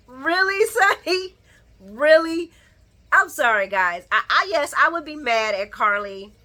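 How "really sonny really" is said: exasperated disbelief. Real, not acted. Exasperated rhetorical to Sonny.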